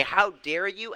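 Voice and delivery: high, indignant mock outburst; mock outrage. Coach imitates an offended client losing it, mock-outraged. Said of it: "you lose your shit and say".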